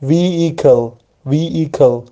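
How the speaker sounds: "ویهیکل" as a drawn-out VEE-i-kel without H; silent H demonstration. Shown as a demonstration. Teacher showing the H is silent, carefully sounding out "ویهیکل" as VEE-i-kel. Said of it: "اچش تلفظ نمیشه".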